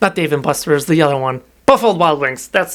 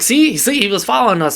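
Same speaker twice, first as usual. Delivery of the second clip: tag insistent; 6 semitones higher than usual. Retold or imitated. Retold argument, Vesper insisting she's right.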